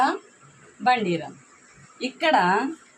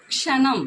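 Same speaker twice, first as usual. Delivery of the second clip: క్షణం said higher and louder; emphasised example word; 5 semitones higher, 5 dB louder, slower than usual. Shown as a demonstration. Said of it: "ఇక్కడ చూడండి". (క్షణం అని గట్టిగా)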